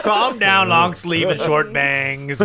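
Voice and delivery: high, loud, stretched-out roast; loud mock jeer, slowing down; roast catchphrase. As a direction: drag out a playful shouted put-down. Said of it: "roasting people always with".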